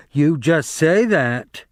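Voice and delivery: slow, sharp scolding; sharply. A narrator's reading. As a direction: cut him off with a sharp rebuke. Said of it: "she said sharply".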